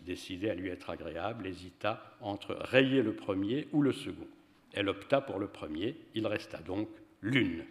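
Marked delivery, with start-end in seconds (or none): none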